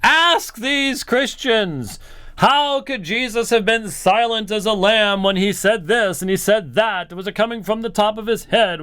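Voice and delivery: high-pitched mocking imitation of a preacher. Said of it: "in this mocking".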